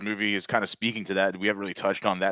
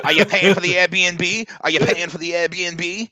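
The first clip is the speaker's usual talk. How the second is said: high, loud, slow mock host asking; mock demanding host; 8 semitones higher, 6 dB louder, slower than usual. Acting the host asking a guest if he pays for the Airbnb. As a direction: drag out each word like a pushy host.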